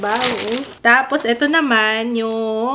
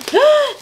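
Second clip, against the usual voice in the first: gasp; 4 dB louder than usual. Real, not acted. Excited reveal of last item.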